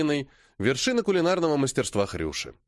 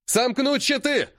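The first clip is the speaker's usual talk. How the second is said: shout; 10 semitones higher, slower than usual. A narrator's reading. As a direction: bellow the order to the line. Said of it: "ору я".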